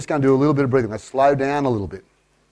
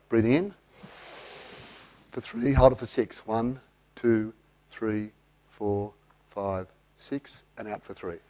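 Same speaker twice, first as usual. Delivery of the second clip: counting softer and softer as the breath is held; fading count; 5 semitones lower, 10 dB quieter, slower than usual. Shown as a demonstration. Coach counting a held breath, fading. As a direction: let the count drift away to almost nothing. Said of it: "counting a held breath".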